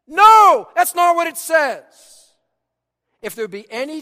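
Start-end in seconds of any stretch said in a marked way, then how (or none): 0.1-2.3 high, shouted rebuttal; loud, forceful pulpit denial; emphatic correction. Real, not acted. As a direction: shout down the false reading. Preacher loudly corrects a misquote.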